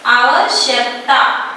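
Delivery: आवश्यकता drawn out slowly; slow pronunciation model. Shown as a demonstration. Teacher modelling a hard word for pupils, आवश्यकता said slowly. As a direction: give each part of आवश्यकता its own time. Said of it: "सही से इसका उच्चारण".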